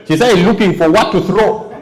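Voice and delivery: usual speaking voice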